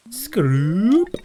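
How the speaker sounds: high, loud zippy whoosh; whooshing cast noise. Pretend. Gamer showing off the rod, high loud whoosh. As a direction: shout the sound of the line flying out.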